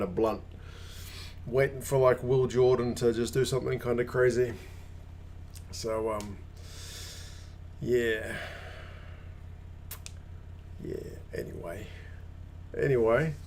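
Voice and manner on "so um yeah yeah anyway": whispered aside. Real, not acted. Muttered aside to himself.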